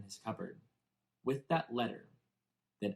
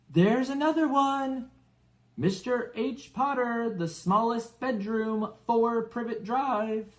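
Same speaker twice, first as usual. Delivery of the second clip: much higher, louder, slow gloating shout; 12 semitones higher, 6 dB louder, slower than usual. A narrator's reading. Dudley shouting out the letter's address to the family. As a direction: call it down the hall, taunting. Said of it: "Then he shouted".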